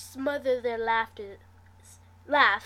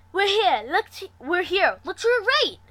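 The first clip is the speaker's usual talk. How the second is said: higher, louder excited calling; gleeful; 8 semitones higher, 8 dB louder than usual. A narrator's reading. Mari calling out to friends on arrival, gleeful. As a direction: bounce with delight. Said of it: "Mari said with glee".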